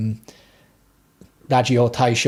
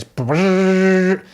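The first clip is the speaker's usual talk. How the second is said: high, slow buzzing hum; coffee machine noise; 7 semitones higher, 3 dB louder than usual. Retold or imitated. To the viewers, mimicking the Nespresso: high buzzing machine noise. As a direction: drag out a mechanical whirr. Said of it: "it goes".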